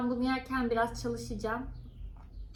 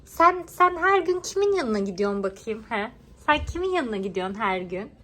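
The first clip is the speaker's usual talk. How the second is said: mock scolding; 6 dB louder than usual. Real, not acted. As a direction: scold the cat teasingly.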